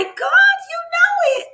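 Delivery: sudden high squeal of joy; squealing delight. Real, not acted. Streamer squealing to chat who got it right. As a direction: squeal it out, thrilled. Excited at Swahili answer.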